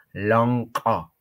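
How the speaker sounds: usual speaking voice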